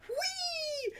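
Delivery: high squeal of delight; playful whee. Real, not acted. Host squealing to viewers about group growth, playful. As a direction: sing out a gleeful whee.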